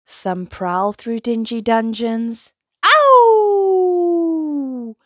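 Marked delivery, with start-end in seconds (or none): 2.8-4.9 high, loud drawn-out monster howl. A narrator's reading. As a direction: howl it out long and loud, falling away. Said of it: "You hear them howling loudly".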